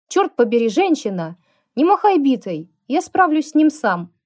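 loud, high pained shout; howling yell. A narrator's reading. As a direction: yell through the pain. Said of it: "взвыл Лукас".

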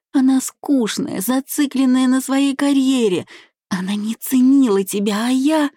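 high, fervent tearful appeal; breathless pleading. A narrator's reading. Tearful bride begging her groom, high fervent pleading. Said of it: "с придыханием добавила".

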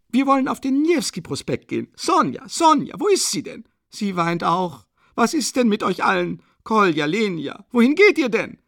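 high, loud, frightened shouts; alarmed calling. A narrator's reading. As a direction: call after the children in sudden alarm. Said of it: "rief sie plötzlich erschrocken". (erschrocken rufend, hoch und laut)

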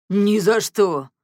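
slow, loud, eager assurance; fervent. A narrator's reading. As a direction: promise it hotly. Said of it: "горячо заверил его Ганс". (горячо и громко, с напором)

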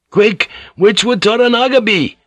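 high, urgent demand; urgent high-pitched question; impatient. Acted. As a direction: ask it impatiently, pitched right up.

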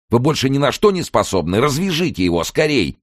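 high, urgent scolding; angry yelling. A narrator's reading. Kern berating his useless cellmate, angry and urgent. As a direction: berate him and order him to hurry. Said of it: "заорал Керн".

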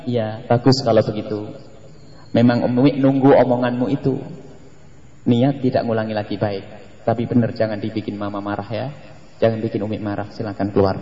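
soft, low motherly reassurance; calm admonishing. Acted. Mother forgiving and dismissing her child, calmly. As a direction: warn gently, in a hushed even voice. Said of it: "Kalau nanyanya kalem ya".

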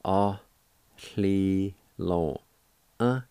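"A li lo uh" with each syllable separated; "A li lo uh" slowly with pauses between syllables. Shown as a demonstration. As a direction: break it into syllables: "A li lo uh". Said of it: "first part is pronounced like this".

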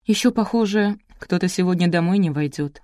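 ordinary delivery

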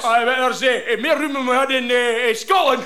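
loud, high broad Scottish Glaswegian, laughing through it. Acted. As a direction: play it up as rowdy Glasgow lads. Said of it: "we were only acting".

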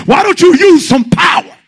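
loud, climbing sermon shout; preaching at full force. Real, not acted. Preacher to congregation, loud impassioned shouting.

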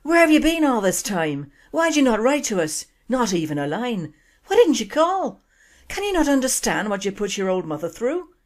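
loud, high Irish dad scolding his daughter; angry Irish father shouting at his long-lost daughter; yelling Irish father. Acted. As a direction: bellow it like a furious Irish father. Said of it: "her father yelled at her".

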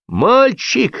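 high-pitched call out of a window; acted high shout. A narrator's reading. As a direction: yell down to a boy outside. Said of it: "закричал в окно Лисипедов".

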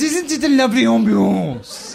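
ऊँची आवाज़ में पत्नी की नकल; हल्की दिखावटी अदा से, ऊँचे सुर में; imitating posh wife. Wife voiced in story.

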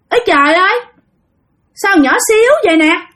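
high-pitched voice of a possessing spirit; possessed aunt's changed voice. Acted. Spirit in aunt Năm remarks on the tiny child, changed high voice. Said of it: "cái giọng của dì Năm thay đổi liền".

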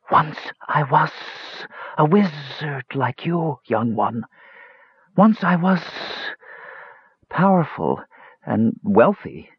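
high, slow, sibilant agony; hissing snake. A narrator's reading. Snake recalling he was once a wizard, hissing. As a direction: linger on every s like a snake. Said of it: "hissed a snake".

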